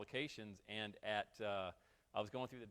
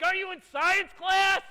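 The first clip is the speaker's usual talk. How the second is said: mock loud question; 18 dB louder, slower than usual. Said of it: "I'm like".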